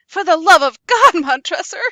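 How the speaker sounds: loud, anguished shout; Fortunato's final desperate plea to Montresor; desperate cry. Acted. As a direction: scream it with the last of his hope.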